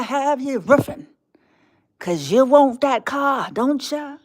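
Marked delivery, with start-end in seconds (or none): none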